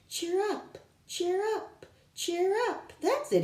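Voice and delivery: rally them with a high, chanted cheer; professor crying out. Acted. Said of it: "he cried".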